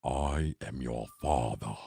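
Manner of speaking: low, slow, soft menacing Vader; deep villain reveal, drawn out; Darth Vader voice. Retold or imitated. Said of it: "I need some echo".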